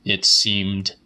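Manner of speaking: usual speaking voice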